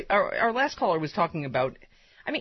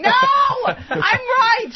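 high, very loud shout; screaming; 8 dB louder, much slower than usual. Retold or imitated. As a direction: yell it like waking from a nightmare. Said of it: "She probably wakes up screaming".

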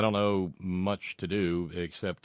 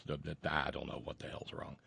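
muttered whisper under breath; 4 semitones lower, 12 dB quieter than usual. Real, not acted. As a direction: whisper it to yourself in frustration. Frustrated aside under breath.

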